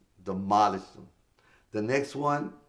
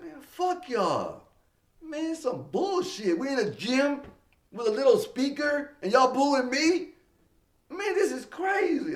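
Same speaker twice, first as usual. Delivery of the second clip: loud outraged tirade; angry rant; 4 dB louder than usual. Retold or imitated. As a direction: rant at the crowd in disbelief. Ranting at booing crowd.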